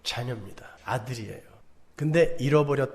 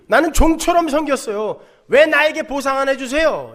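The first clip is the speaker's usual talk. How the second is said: loud, high whining demand for reward; elder son to father, resentful and loud; resentful complaint; 8 semitones higher, 9 dB louder than usual. Retold or imitated. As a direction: complain like an underpaid servant. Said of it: "스스로 그렇게 이야기하고 있어요".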